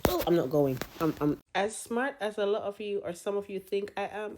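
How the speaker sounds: ordinary talk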